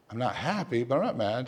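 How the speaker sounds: quiet, measured retold reply; subdued. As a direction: say it low-key, softly, weighing each half. Retold exchange with daughter, subdued.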